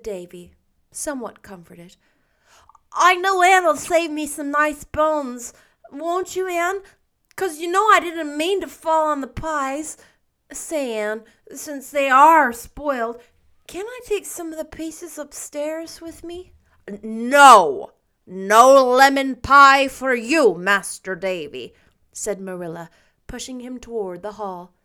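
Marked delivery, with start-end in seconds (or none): 2.9-16.5 put-on high, loud coaxing boy voice; wheedling small boy. A narrator's reading. Davy coaxes Anne for pie, high wheedling boy. 16.9-21.7 loud, slow, firm no; stern refusal. A narrator's reading. Marilla refuses Davy the pie, loud and stern.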